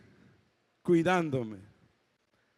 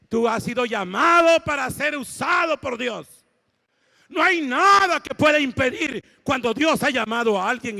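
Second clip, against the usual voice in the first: loud, high, fervent preaching; preaching shout; 8 semitones higher, 8 dB louder than usual. Retold or imitated. Preacher calling out to the man lying down, loud shout. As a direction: proclaim it full force over the crowd.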